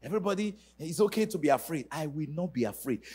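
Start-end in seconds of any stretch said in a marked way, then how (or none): none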